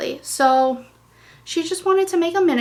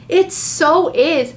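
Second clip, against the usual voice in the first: excited; 4 semitones higher, 7 dB louder, slower than usual. Real, not acted. Excited about color scheme.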